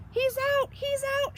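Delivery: high, excited cheer; little boy cheering; excited shout. Acted. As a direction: shout it out with joy. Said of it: "shouts Sam".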